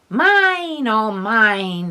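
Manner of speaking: high, loud gloating crow; gloating cackle. A narrator's reading. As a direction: gloat over the pile of money. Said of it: "he cackled".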